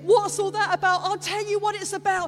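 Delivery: high, loud sermon delivery; fervent Easter preaching; passionate preaching. Acted. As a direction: drive the message home to the congregation. Preaching at full force.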